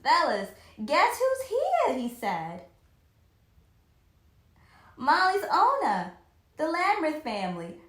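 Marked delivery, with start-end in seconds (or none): 0.0-2.0 put-on bright, loud, higher call to the boys; excited. A narrator's reading. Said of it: "Doc Rogers was very excited". 5.0-7.8 loud, higher, eager announcement; excited. A narrator's reading. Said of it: "Doc Rogers was very excited".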